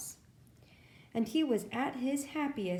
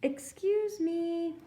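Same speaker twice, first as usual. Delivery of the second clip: higher, slow and careful; a shy bear politely asking; lightly voiced timid bear, slow and high; 6 semitones higher, slower than usual. Bear asks animals politely for help.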